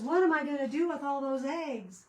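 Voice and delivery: high, loud mock complaint; imitating husband. Retelling husband's question about all those Easter eggs, imitated complaint. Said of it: "He goes".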